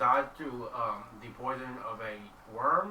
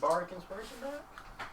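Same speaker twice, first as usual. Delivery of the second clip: higher, slow rising question; voiced repeat; 9 semitones higher than usual. Real, not acted. Player repeating the request to Max, high rising question. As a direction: repeat it aloud, pitched up hopefully.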